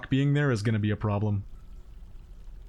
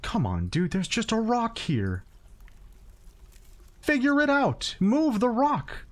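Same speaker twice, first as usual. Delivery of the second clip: exasperated; 7 semitones higher than usual. Real, not acted. Yelling at dwarves over rock.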